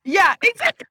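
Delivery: loud, high laughing yes; excited agreement. Real, not acted. Agreeing loudly while laughing.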